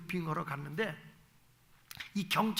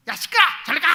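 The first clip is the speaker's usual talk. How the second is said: much higher and louder, snapping; mother yelling at the kids while watching TV, angry shout; annoyed shout; 9 semitones higher, 11 dB louder, faster than usual. Shown as a demonstration. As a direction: shoo the kids off angrily. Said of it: "이러지 말고".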